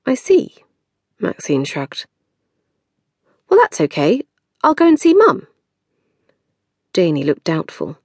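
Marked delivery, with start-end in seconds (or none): 0.1-5.5 high, brave-faced sulk; sulky younger sister. Acted. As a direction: shrug it off, pitched up. Maxine shrugging off hurt.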